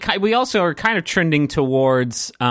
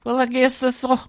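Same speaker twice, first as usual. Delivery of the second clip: high Southern accent, mock woe; hammed-up Southern lament; dejected Southern owner; 11 semitones higher than usual. Southern biscuit-shop owner after a bad review, woeful Southern accent. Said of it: "Mama's Southern Biscuits down in Savannah".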